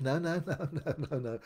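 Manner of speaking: no no with a laugh breaking in; laughing denial. Retold or imitated. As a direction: laugh off the accusation. Denying with a laugh.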